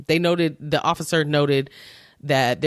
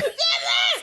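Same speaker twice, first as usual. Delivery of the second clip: burst of laughter. Real, not acted. Laughing at restart.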